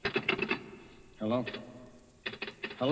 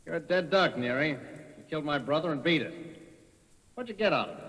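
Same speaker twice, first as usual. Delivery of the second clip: loud, raised threat; menacing; 5 semitones higher, 4 dB louder than usual. Acted. Brother threatens the accused man at gunpoint, raised and harsh. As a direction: lean in and threaten him.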